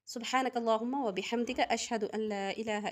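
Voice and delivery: quiet, reverent recitation of the closing dua; soft closing prayer. Acted. Teacher closes the class with a soft recited prayer. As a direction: murmur the dua softly to close the lesson.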